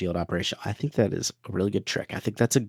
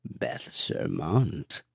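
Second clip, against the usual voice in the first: a lightly done sinister Emperor, very slow; 5 semitones lower, 6 dB quieter, much slower than usual. Acted. Cohost reading patron names as the Emperor. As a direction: drag the name out like an evil old Emperor. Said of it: "Let's do it in Emperor voice".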